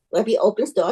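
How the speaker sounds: plain reading voice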